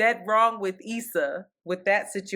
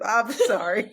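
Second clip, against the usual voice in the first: ordinary delivery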